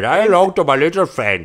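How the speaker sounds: put-on Indian accent on the Scarface line; Indian Al Pacino. Friend joking on podcast, mock Indian accent. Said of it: "like Indian Al Pacino".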